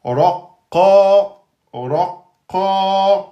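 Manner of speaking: prolonged vowel model; long vowel demo. Shown as a demonstration. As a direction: stretch the final a of "raqa". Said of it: "ça veut dire prolongation".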